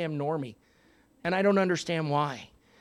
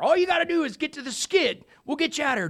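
higher, calling over the noise; helicopter rescuer; 9 semitones higher, 4 dB louder than usual. Acted. A helicopter pilot calls to the stranded man, raised and high. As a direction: yell up the instructions.

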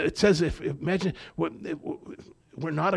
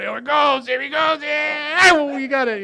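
high, loud excited encouragement; root for the newcomer, thrilled; cheering on; 13 semitones higher, 8 dB louder than usual. Retold or imitated. The group cheering the newcomer through the word, high excited cheering.

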